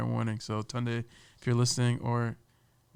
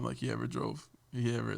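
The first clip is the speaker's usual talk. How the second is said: ordinary delivery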